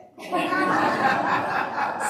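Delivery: breathy burst of real laughter; laughing out loud at the Robert Frost quote. Real, not acted. Minister laughs at the joke she just read, real laughter. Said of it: "we were just laughing about that".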